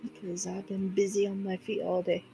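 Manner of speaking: ordinary delivery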